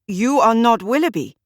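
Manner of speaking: stunned to see the wrong man; baffled. A narrator's reading. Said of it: "he said in complete bafflement".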